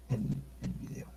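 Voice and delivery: normal talking voice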